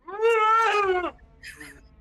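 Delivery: huge high laughing shriek; screaming laugh outburst; mock Tourette's yell. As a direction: let out a wild shrieking burst.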